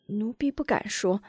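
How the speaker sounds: soft, backing off; meek quiet maid; timid refusal. Acted.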